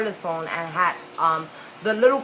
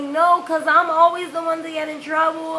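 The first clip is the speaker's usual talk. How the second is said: high-pitched whiny protest; retold tearful complaint, voice pushed up; 7 semitones higher than usual. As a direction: imitate a crying kid defending herself. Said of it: "I'm crying".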